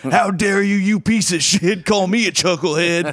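hammed-up outraged listener; mock angry email. Host reading an angry listener email, mock fury. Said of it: "emails that are like".

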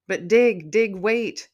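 loud, high plea to hold on; urgent calling out. A narrator's reading. Mom calls after Digger as he must go, urgent call. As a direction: call after him to stop.

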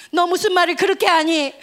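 loud, upset scolding; mock scolding from the sister. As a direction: reproach him sharply, hurt. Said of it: "막 이러면서 언니가 막 뭐라 했어".

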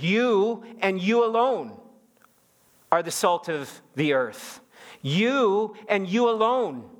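YOU drawn out high and slow, then YOU ALONE; emphatic. Retold or imitated. Preacher showing how Jesus addressed his disciples, emphatic. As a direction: stretch and lift each YOU with weighty emphasis. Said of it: "it's in the emphatic tense".

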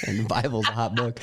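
laughing through the words. Real, not acted. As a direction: say it while laughing. Joking about the Bible.

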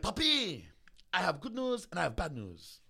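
high, loud put-on character voice; Fabian. Trainer breaking good and bad diet news, put-on voice.

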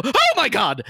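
high, alarmed gasp of a voice; startled shopkeeper. Acted. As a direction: yelp it out, frightened. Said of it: "you see this man go".

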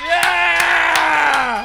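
loud, high whoop of joy; cheering shout. Real, not acted. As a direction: cheer back at the screen. Cheering after the toast.

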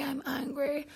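"angry" breathed as a near-silent whisper; whispered aside. Shown as a demonstration. Host repeating she is angry to viewers, whispered. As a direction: drop to a hush on "I'm angry". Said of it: "Clearly I'm angry".

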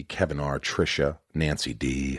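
normal talking voice

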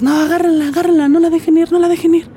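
high, loud yell to grab her, heard from afar; retold distant yelling. Attackers shouting at each other to catch her, high loud yells. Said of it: "Y escucho de lejos".

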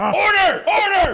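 very high, loud gavel shout; shouted order. Acted. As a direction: bellow for order over the room. Judge bangs for order, laughing.

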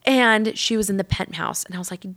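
ordinary talk, nothing marked

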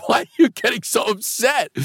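high-pitched, retold dismay; upset concern. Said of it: "And he got upset".

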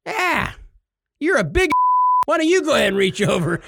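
put-on high, cranky old-man voice. The old man speaking to Bill in a high, cranky voice.

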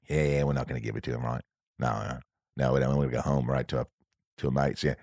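low, soft and fast, conspiring in a corner; mock huddled crew muttering. Crew in a corner mocking the director's request, hushed fast mutter.

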